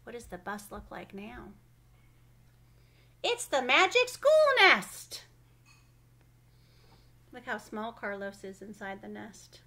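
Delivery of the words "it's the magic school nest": excited announcement. A narrator's reading. Bus becomes a nest, announcement.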